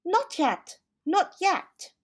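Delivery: high, loud flustered rabbit; fussy rabbit voice. A narrator's reading. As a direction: hurry them, high and anxious.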